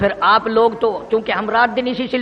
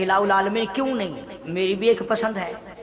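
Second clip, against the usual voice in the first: very soft, low, reverent voice; humble reply; 5 semitones lower, 7 dB quieter than usual. Acted. Jibreel humbly answering God's question, hushed and low. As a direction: drop to a hushed, submissive answer.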